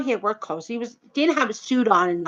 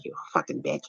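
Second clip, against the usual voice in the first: muttered insult, voice dropped right down; under his breath; 7 semitones lower, 13 dB quieter than usual. Retold or imitated. Rude customer curses her under his breath. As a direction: mutter it so only she hears. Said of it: "under his breath".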